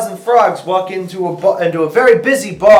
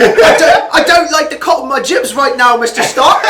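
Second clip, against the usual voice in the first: high laughing mock British teen voice; 9 semitones higher, 5 dB louder than usual. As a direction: Peter Parker to Mister Stark, overdone British. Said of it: "how's your British accent is the thing".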